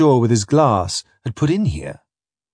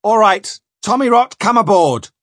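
high, loud captain's order; calling out; 9 semitones higher, 4 dB louder than usual. Acted. Spring ordering Tommy Rot aboard, calling out. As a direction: call him up onto the deck.

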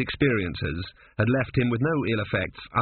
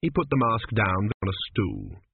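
ordinary delivery

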